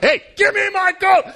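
high-pitched yell of protest; indignant shout. Acted. Man demanding his coat back, indignant shout. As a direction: call out as if tugging it back.

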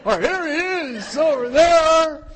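high, loud and slow, hammed-up; frantic pointing out. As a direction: drag it out, desperate for rescue. Isaac pointing Dad out.

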